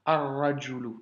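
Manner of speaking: Ar-rajulu, r doublé, lam muet; Ar-rajulu sans prononcer le L; liaison solaire. Shown as a demonstration. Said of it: "le lam disparaît". (prononciation arabe modèle)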